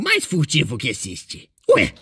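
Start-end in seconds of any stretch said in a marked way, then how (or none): none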